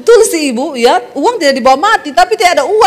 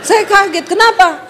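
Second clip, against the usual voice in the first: ordinary delivery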